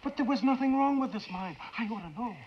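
high, insistent objection; heated high-pitched protest; agitated protest. Acted. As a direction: push back hard, voice rising. Protests friend's supposed suicide.

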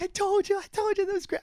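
high, gushing agent voice; excited agent. Retold or imitated. Agent rushes up after the show, gushing high. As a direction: gush like a thrilled agent. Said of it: "She's like".

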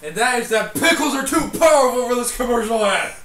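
mock proclamation. Co-host gives his last word, mock-grand shout. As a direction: proclaim it like an absurd slogan. Mock declaration about pickles.

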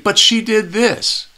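high, drawn-out protest; mock player protest.

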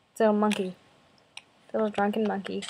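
ordinary delivery

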